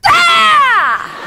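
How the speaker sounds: one long, high, loud ki up; explosive martial arts yell; demonstrated yell. Shown as a demonstration. Instructor shows volunteer the board-breaking yell, high loud shout. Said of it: "I want you to go".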